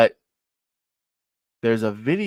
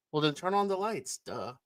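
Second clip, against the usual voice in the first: usual speaking voice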